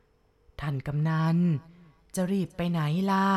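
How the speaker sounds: slow, drawn-out ghostly call. Acted. Ghost head calling the village chief from behind, slow eerie call. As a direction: stretch the name out like a ghost calling from the dark.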